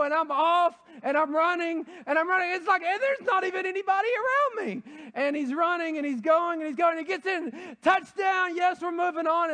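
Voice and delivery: high, loud, building to a shout; preacher reliving the touchdown run, shouting; excited play-by-play. Retold or imitated. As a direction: call the run like a thrilled announcer. Retelling the wrong-way run.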